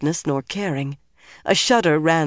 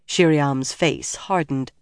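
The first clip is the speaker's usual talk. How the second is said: usual speaking voice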